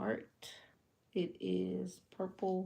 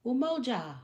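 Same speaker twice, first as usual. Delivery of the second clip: "Umoja." called out louder and higher; proud answer; 7 semitones higher, 7 dB louder than usual. Shown as a demonstration. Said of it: "you'll say".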